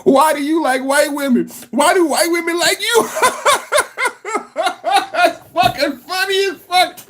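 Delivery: high, giggling mock questions; laughing. Real, not acted. Host mocking the clip, high laughing questions. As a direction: ask it through laughter.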